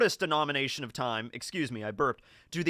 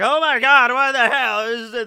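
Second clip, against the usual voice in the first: loud, high-pitched shocked outcry; shocked shouting; 8 semitones higher, 11 dB louder, slower than usual. Acted. Elevator crowd sees Borat run in naked, shocked shouting. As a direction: shout it like a startled bystander.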